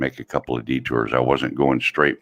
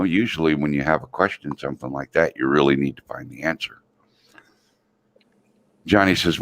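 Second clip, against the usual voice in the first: ordinary talk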